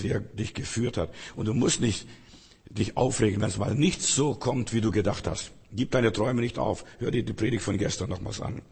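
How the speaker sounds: ordinary delivery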